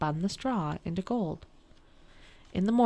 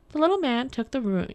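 ordinary delivery, nothing marked

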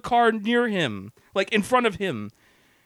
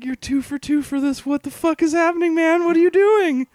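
rising higher and louder in disbelief; incredulous; 7 semitones higher, 3 dB louder than usual. Retold or imitated. Driver watching the van swerve, rising exasperated disbelief. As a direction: build from puzzled to exasperated. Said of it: "And I'm just kinda going".

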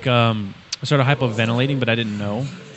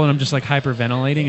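ordinary delivery

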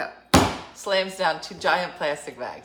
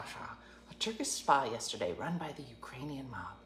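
hushed conspiratorial whisper; 6 semitones lower, 10 dB quieter than usual. Real, not acted. Telling friends about the Ukrainian mob spa, whispered. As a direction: lower your voice like it's a secret. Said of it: "conspiratorial aside".

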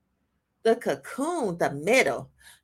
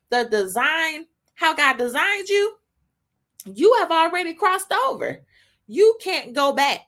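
raised, emphatic preacher's voice; impassioned preaching; 6 semitones higher, 4 dB louder than usual. Real, not acted. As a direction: drive it home from the pulpit, pitched high. Preaches with full force.

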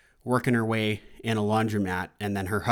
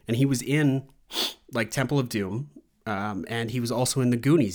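ordinary delivery

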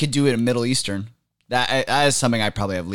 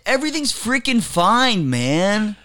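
high-pitched, easygoing slacker impression; the Dude; 10 semitones higher than usual. Guest showing off his impression to co-host, high slacker voice. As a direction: reassure like a put-on movie slacker. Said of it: "Oh, you could do the dude".

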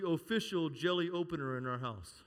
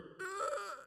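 strained grunt; 5 dB quieter than usual. Retold or imitated. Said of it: "I was like".

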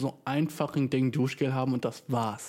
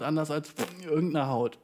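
usual speaking voice

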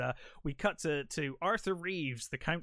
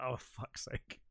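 low-volume mock grudging mutter; begrudging; 3 semitones lower, 8 dB quieter, much slower than usual. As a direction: grumble it under the breath, imitating reluctance. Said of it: "he's so begrudgingly like".

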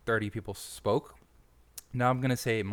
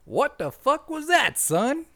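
much higher, louder, gruff put-on chief; mock chief yell; 8 dB louder, slower than usual. Fire chief yells at the recruit who flubbed his line. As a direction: blast the recruit like an outraged chief. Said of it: "he's like".

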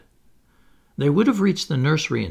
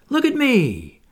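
higher and louder, calling out; Wendy's delight climbing higher; rising excitement; 11 semitones higher, 5 dB louder than usual. A narrator's reading. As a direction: call for everyone to look. Wendy calling while flying.

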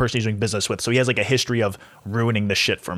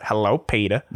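lightly voiced Cleveland greeting; hint of the cartoon neighbour; Cleveland impression; 2 semitones lower than usual. Proving her only impression again, a light Cleveland voice. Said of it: "I can't do anything else but".

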